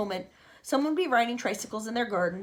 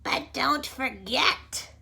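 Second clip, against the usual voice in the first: Aunt Spiker's voice; 2 semitones higher, much slower than usual. Acted. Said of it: "Aunt Spiker cried".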